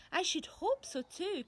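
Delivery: a hint of a crabby old woman; grumpy granny. A narrator's reading. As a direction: snap back like a cranky grandma. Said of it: "came the grumpy reply".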